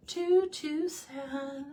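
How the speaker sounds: high, very slow mock wail. Real, not acted. Telling viewers a cartoon is too sad, mock-wailing. Repeats verdict, drawn out.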